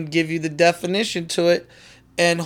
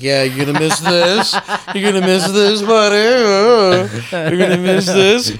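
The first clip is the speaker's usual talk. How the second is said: mocking, sing-song taunt; taunting tease; 2 semitones higher, slower than usual. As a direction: tempt him with a smirk. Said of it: "and be like".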